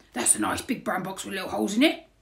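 quick, loud burglar voice; cheeky burglar sizing up loot briskly; speedy burglar patter, rising at the end; burglar character. A narrator's reading. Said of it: "said Burglar Bill".

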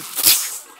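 quick unvoiced "pst" sound effect; imitated needle jab. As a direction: make a sharp puff for a needle jab. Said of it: "con la aguja le hizo así".

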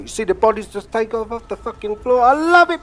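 rising into a loud, high whoop; thrilled yell. Real, not acted. Gamer shouting to viewers about flying bodies, loud and high. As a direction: celebrate the explosion at full volume.

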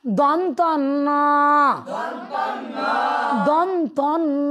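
দন্তান্ন spelled out slowly, syllable by syllable; slow model of দন্তান্ন for the class to repeat; teacher slowly drilling দন্তান্ন for pupils. Shown as a demonstration. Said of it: "কি বলতে হবে?".

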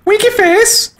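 very high squeaky cartoon voice; squeaky winky voice. Shown as a demonstration. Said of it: "I don't know how to get my voice that tone".

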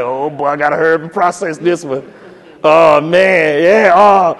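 low, grumbled worry of a pressured recipient; flustered. Retold or imitated. Email recipient seeing the provost copied, flustered. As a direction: mumble to yourself as if suddenly under pressure. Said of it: "makes the person in the two be like".

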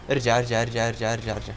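low urgent chant fading out; fading urgent mutter. Real, not acted. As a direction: keep urging him back as it dies to a murmur. Urging teammate back in game.

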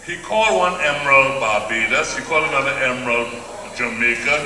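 normal talking voice

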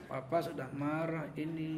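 slow, low-key mock anger through tight teeth; very quiet, teeth-clenched telling-off; retold quiet anger. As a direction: imitate a dad's soft, drawn-out warning. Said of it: "Kalau marah sama anak gitu".

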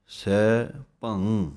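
ਸੈਭੰ slow, ending ਭੰ through the nose; nasal ending. Shown as a demonstration. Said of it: "ਨੱਕ ਵਿੱਚ ਆਵਾਜ਼ ਆਉਣੀ ਚਾਹੀਦੀ ਹੈ". (ਸੈਭੰ ਵਿੱਚ ਭੰ ਨੱਕ ਵਿੱਚੋਂ)

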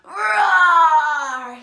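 long, very loud roar; lion roar. Shown as a demonstration. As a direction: roar like a lion for children to copy. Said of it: "Can you roar like a lion?".

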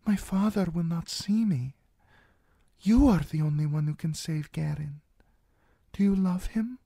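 put-on high, coaxing young princess; cartoon princess. A narrator's reading. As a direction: gently press her for the truth. Princess voiced in audiobook.

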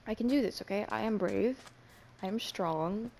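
normal talking voice

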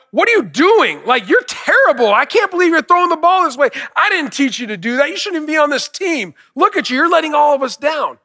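high, loud berating of a struggling son; harsh scolding father. Retold or imitated. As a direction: yell at him like a disappointed coach dad. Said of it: "You know what legalism would have sounded like?".

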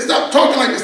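mock scolding, pitched well up; exasperated wife. Wife silencing her husband's plane-crash talk, high mock exasperation.

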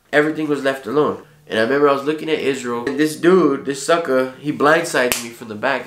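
ordinary talk

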